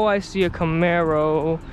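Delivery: ordinary delivery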